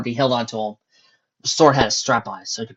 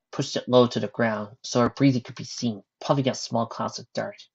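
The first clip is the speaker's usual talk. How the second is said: ordinary delivery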